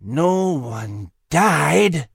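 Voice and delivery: very slow, menacing scorn; drawn-out, sinister reproach; sneering villain. A narrator's reading. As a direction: draw each word out with menace. Villain scorns the rat's failure.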